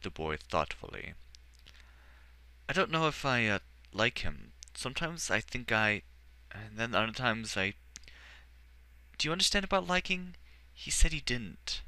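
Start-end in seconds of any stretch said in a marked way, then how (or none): 2.7-11.8 put-on higher, eager small-boy voice; a shy boy, unsure how he feels; young boy voice. Boy confiding in the Archmagister, higher childlike voice.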